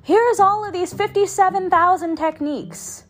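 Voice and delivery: high-pitched coach rattling off a list; mock pushy coach. Mimicking a coach piling on techniques.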